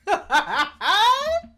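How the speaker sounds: big high-pitched burst of laughter; real, uncontrolled high cackle; loud high laugh. Real, not acted. Reacting with amusement to the anime.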